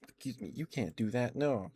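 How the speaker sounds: normal talking voice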